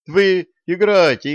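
high, loud urging. A narrator's reading. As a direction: push them on loudly. Vitalik waves them on.

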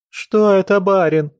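high tearful wail. A narrator's reading. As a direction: wail it out in tears. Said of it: "вопила она с плачущим".

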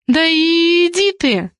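high, slow, stretched-out scoff. A narrator's reading. Girl brushing off the boy's demand, scoffing. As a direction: draw it out in high-pitched dismissal. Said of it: "фыркнула Зоя".

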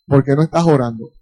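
a hushed, pointed reply to the friend; quiet retold answer. Preacher quietly tells the complaining caller why. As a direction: drop the voice low and soft to answer.

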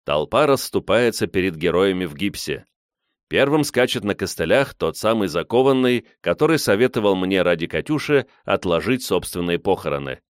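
plain reading voice

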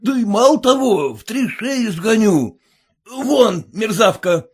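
high, loud scolding; angry shout. A narrator's reading. Merchant shouting at the cook to get out. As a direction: drive her out with a shout. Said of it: "крикнул Захар Иванович на кухарку".

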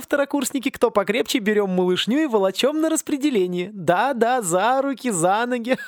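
high, bright-hearted bossy teacher herding freshmen; a gleeful, teasing headmistress with a laugh; jolly teacher voice. Acted. Teacher cheerfully bossing the seasick freshmen, high and teasing.

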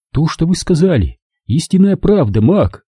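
lightly voiced woman, gently confirming; gentle woman's voice. A narrator's reading. Claudia touching Mac's shoulder, speaking gently. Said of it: "Нежно коснувшись его плеча".

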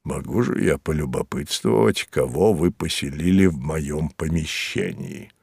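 low, soft, put-on busybody voice; pry in a low, quiet grumble; grumbling character voice. A narrator's reading. Mozes asking about the stranger in his room, low grumble.

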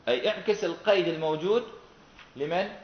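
normal talking voice

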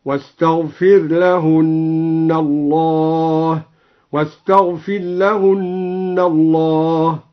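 chanted slowly, "واستغفر لهن" with r merged into l, then with r clear; Quran recitation of the raa. Shown as a demonstration. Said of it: "قرأ بإدغام الراء وإظهارها". (ترتيل بإدغام الراء ثم إظهارها)

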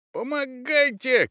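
high, drawn-out shout; cry for help. A narrator's reading. Thief pinning a man with the door, shouting for help. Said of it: "кричал ёж".

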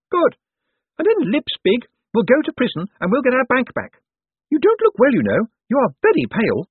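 high-pitched, pushy woman's voice; bossy sister. A narrator's reading. Sister urging her brother on about the hostage, bossy. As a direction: play a demanding sister, pitched well up.

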